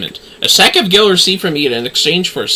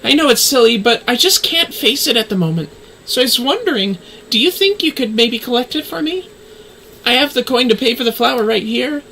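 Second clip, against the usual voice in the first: put-on higher, downcast young woman's voice; voiced NPC girl; 10 semitones higher than usual. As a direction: play the gloomy girl asking a favour, pitched up. Same NPC continues her request.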